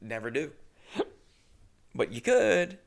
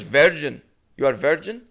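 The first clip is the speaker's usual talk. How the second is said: put-on loud, insistent Indian-accented repeat; Indian accent impression; 3 semitones higher, 7 dB louder than usual. Clerk repeating his question, loud Indian accent. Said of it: "unfortunately, keep doing it".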